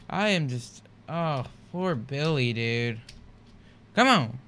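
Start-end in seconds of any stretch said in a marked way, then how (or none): 3.9-4.4 sharp, loud come on; frustrated shout. Real, not acted. Frustrated at game.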